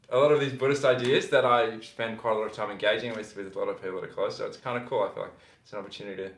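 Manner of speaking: ordinary talk, nothing marked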